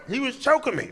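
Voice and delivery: high, outraged protest; can't believe the accusation; indignant protest. Retold or imitated. Drunk roommate protesting to cop.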